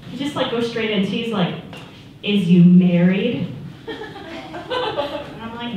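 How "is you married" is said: slow imitation of a pushy suitor; drawn-out chat-up. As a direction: draw the question out, mimicking him. Man's awkward chat-up question retold.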